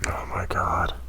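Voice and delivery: hushed whisper of dread. Real, not acted. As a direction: breathe it out, scared. Dread at the goblin camp.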